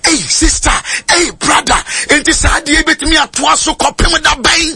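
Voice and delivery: high, sing-song preacher's cry; high preaching cry. Real, not acted. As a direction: lift it up in a chanting call. Preacher's climax over music.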